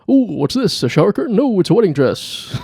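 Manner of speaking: high, excited manic Robin Williams riff; Robin Williams impression. Podcaster riffing on Robin Williams in the shower, manic and high. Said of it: "Robin Williams in the shower".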